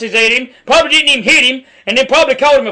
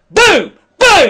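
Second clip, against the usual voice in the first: mock punch sounds, getting louder and higher; imitated blows; 8 semitones higher, 4 dB louder than usual. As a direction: slam out each boom like a hit. Acts out the blows on video.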